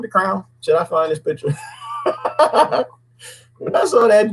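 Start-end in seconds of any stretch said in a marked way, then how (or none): 1.6-2.8 high, open giggle; real burst of high laughter; laughing. Real, not acted. Host tickled by the dude with the crown, laughing. Amused by costumed rioter.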